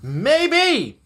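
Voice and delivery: MAYBE pitched high, falling at the end; high, emphatic "Maybe". Real, not acted. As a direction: draw out a high, swooping "Maybe". Answers own question, first take.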